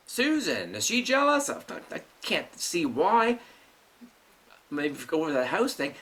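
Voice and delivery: ordinary talk, nothing marked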